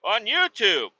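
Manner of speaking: high-pitched comic whining; worked up, voice pitched high; frustrated whine. Retold or imitated. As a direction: plead it in exasperation.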